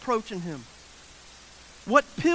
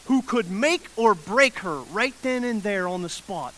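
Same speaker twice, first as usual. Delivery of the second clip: ordinary delivery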